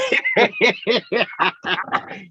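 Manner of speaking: rising laugh. Real, not acted. Friends laughing at shell suit story, rising laugh. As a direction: laugh along.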